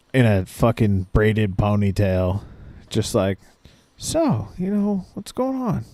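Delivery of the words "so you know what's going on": lightly high, soft hippie doctor impression; mock doctor voice. Patient imitating the long-haired doctor asking what's wrong, high soft voice. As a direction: imitate the laid-back doctor, pitched up and gentle.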